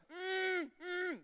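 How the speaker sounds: mate calling a diver with closed-mouth hums. Retold or imitated. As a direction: hum sharply twice through closed lips. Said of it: "making a louder noise".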